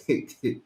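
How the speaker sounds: low amused chuckle; short laugh to yourself. Real, not acted. Commentator amused by rivals' complaints, chuckling.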